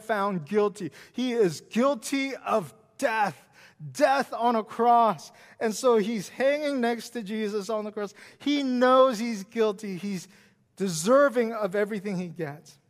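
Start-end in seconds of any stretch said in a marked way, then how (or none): none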